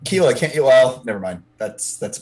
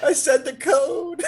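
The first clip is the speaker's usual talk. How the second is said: laughing; 11 semitones higher, 3 dB quieter, slower than usual. Real, not acted. Player laughing with glee.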